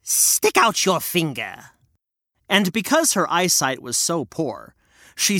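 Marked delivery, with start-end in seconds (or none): 0.0-1.7 slow hag command; demanding witch. Acted. Witch checks Hansel, hag command. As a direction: snap it out slowly. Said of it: "and say".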